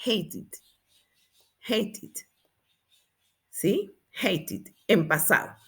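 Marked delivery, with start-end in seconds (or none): 0.0-2.1 "Hated" in two syllables, ending in "id"; "id" ending demo. Shown as a demonstration. Teacher shows the past of hate, "Hated" softly with an added "id". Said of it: "Como id, el pasado". 4.1-4.7 "Hated" in two syllables, "hei-tid"; soft, careful "Hated" with "id" at the end; "id" ending demo. Shown as a demonstration. Teacher confirms the past for students, "Hated" softly with "id". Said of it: "Como id".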